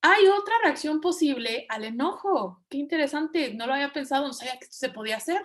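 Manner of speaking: high, amazed voice of a brain discovering something; surprised brain. Retold or imitated. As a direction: play the brain going "oh wow, I never knew". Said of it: "Tu cerebro dice".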